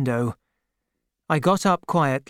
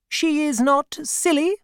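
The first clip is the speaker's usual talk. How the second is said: higher voiced brisk old aunt; a kindly, no-nonsense old aunt greeting an old acquaintance; elderly aunt; 12 semitones higher than usual. A narrator's reading. Aunt greeting Peggotty, higher brisk aunt voice. Aunt's voice, held.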